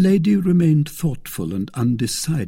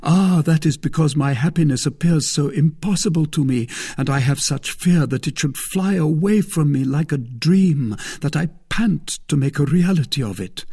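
high, loud, impassioned outpouring; carried away; 7 semitones higher, 6 dB louder than usual. A narrator's reading. Suitor inflamed by lady, passionately carried away. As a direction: pour it out, swept up by passion. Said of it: "really carried away by the passion".

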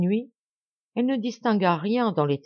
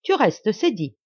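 high and loud, then easing; joyful cry; 3 semitones higher, 5 dB louder, slower than usual. Acted. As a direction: press the point home gladly. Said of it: "s'écria-t-elle".

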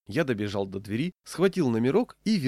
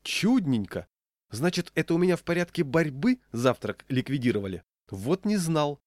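higher-pitched mock wonder; sarcastic delight; 6 semitones higher than usual. A narrator's reading. Diner mocks the manageress over his breakfast, sarcastic delight. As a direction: feign delighted discovery with biting irony.

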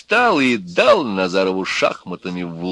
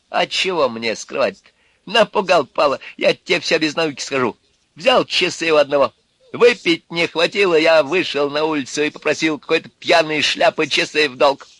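higher, louder contemptuous rant; sneering thug; 7 semitones higher, 4 dB louder than usual. A narrator's reading. Urka mocking the apologizers, scornful rant. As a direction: spit scorn at the polite ones.

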